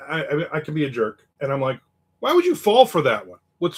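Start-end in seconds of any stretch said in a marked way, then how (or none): 2.2-3.4 higher, louder disbelieving jab; incredulous scoff. Retold or imitated. Mocking a scam victim, loud incredulous scoff. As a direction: scoff at them for falling for it.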